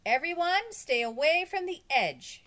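loud, high warning call. A narrator's reading. Teacher warns children on the windy roof, loud high call. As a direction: call out to keep everyone safe. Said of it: "she warned".

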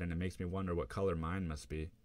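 ordinary delivery

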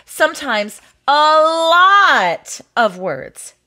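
"lot" stretched long and loud; exaggerated complaint. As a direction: blow up "lot" slowly, as if it were endless. Complains about wordy pages.

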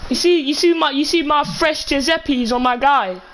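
usual speaking voice

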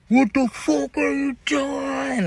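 high, slow yell heard from far off; a guard shouting across the yard in the storm; distant shout. Retold or imitated. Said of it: "Like, very lightly".

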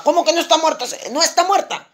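high-pitched, incredulous questions; astonished disbelief. Acted. Padre atónito ante el doctor. (atónito, con la voz aguda)

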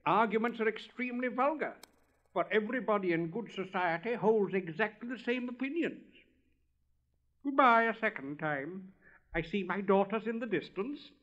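bubbly, talkative little frog; chatty frog. Acted. Frog greeting the rocket in the ditch, loud and cheerful. Frog's voice.

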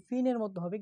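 ordinary talk, nothing marked

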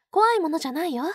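ordinary talk, nothing marked

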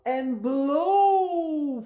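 very loud, long swooping "blow"; big wolf blow. Shown as a demonstration. Teacher showing children how to blow, loud drawn-out swoop. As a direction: stretch it out like a gust.

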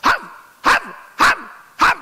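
short sharp barks, high; mock dog barks, pitched high; barking like dogs. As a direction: bark like a howling dog. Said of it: "אנשים שצווחים כמו כלבים".

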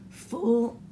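throat catching mid-sentence; choking. Real, not acted. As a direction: choke on the words. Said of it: "y'all, I'm choking".

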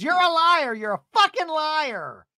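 high, loud, exaggerated accusation; hammed-up rage, stretching the last words; mock furious yell. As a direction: imitate a screaming reality star. Acting Tamara's confrontation clip.

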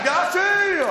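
triumphant punchline shout. Real, not acted.

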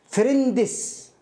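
فريندس in two slow syllables, ending in a separate "dis"; imitated student mispronunciation. Teacher mimicking students reading the word, mispronounced. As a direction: add an extra vowel, making فريندس "frin-dis". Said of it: "فيقرأها".

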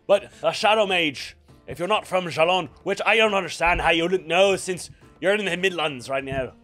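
gruff barkeep, loud and hearty; tavern keeper voice. Acted. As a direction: play the innkeeper with swagger. Barkeep explains lore.

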